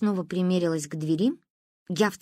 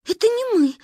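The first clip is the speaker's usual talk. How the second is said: put-on high, urgent children's denial; hasty protest; 13 semitones higher, 4 dB louder than usual. A narrator's reading. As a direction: blurt out your innocence. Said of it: "зачастили мы с Кариной".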